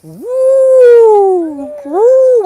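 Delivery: high, loud trumpeting woo; hammed-up elephant trumpet; elephant call. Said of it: "Can we do elephant?".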